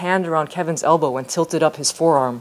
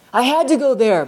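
protesting; 8 semitones higher than usual. Acted. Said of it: "Billy protested".

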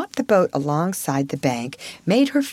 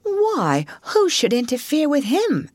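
high, swooping question; puzzled surprise; 9 semitones higher than usual. A narrator's reading. Mole asks Rat about Badger, puzzled surprise. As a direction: pitch it high like an innocent, baffled Mole.

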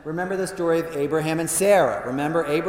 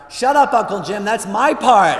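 loud, higher mock yell from a kid; retold yell; 6 semitones higher, 9 dB louder than usual. Nephew cuts off uncle at grace, mock loud yell. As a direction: bark it like an indignant nephew. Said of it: "Matthew yells out".

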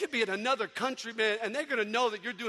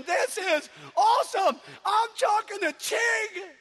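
high, loud, slowed shriek; thrilled fan shrieking; berserk excitement; 10 semitones higher, 5 dB louder, slower than usual. Retold or imitated. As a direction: scream with joy at the phone. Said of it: "She's going berserk".

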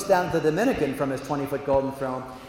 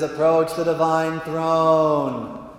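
slow, intoned grand command; God the Father; 4 dB louder, much slower than usual. Acted. God repeating summons to the paralyzed men, slow intonation. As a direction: chant it on one note, drawn out. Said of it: "And he says".

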